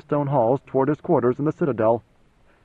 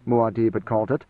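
ordinary delivery

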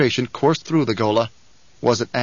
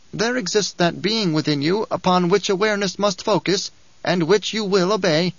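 higher, sing-song voice of a scheming dwarf; a sly little dwarf preaching the uses of war; sly dwarf Bijaz; 5 semitones higher than usual. Acted. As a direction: lecture like a gleeful little schemer. Bijaz commands awareness.

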